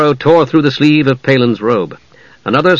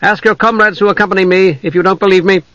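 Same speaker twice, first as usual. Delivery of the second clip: higher, projected assertion; authoritative half-elf; 7 semitones higher, faster than usual. Acted. Envoy challenges elves to check his word, authoritative. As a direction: state it firmly to the troop.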